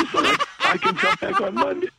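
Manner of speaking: high laughing mock sick voice. Excuse to casting people, high laughing put-on voice. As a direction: laugh through a put-on strained voice.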